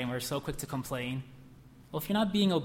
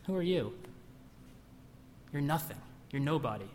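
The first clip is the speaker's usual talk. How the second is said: mocking devil; 4 dB quieter than usual. Preacher voicing the devil's laughing sneer. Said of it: "devil's taunt, mocking laugh".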